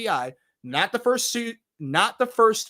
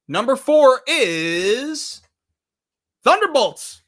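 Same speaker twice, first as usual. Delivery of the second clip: drawn-out, louder announcement with higher pitch; countdown reveal; 6 semitones higher, 7 dB louder, much slower than usual. Real, not acted. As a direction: stretch the IS, then announce the title. Countdown reveal of next pick.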